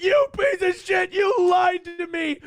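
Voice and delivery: high, loud, slow screaming rage; furious shout. Retold or imitated. Nick screaming at his dad that he lied, furious high shout. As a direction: scream it like a betrayed, heartbroken kid. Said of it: "who was most mad about it? Nick".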